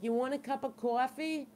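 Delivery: put-on New York accent. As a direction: ask it like a New Yorker. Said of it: "I got the Boston, New York accent going on here".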